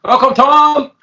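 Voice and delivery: loud, high guest welcome; hearty welcome. Acted. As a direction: call it out like a show host.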